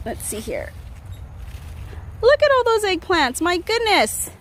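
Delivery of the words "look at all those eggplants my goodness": high, loud delighted exclaim; light up at the harvest; excited. Real, not acted. Gardener thrilled to find a pile of eggplants, high delighted exclaim.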